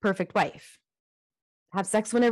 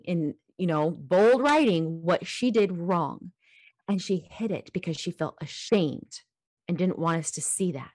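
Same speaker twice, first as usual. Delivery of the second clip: normal talking voice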